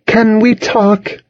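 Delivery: high, slow, sweet request; coaxing. A narrator's reading. Charlotte batting eyelashes at her ex, coaxing.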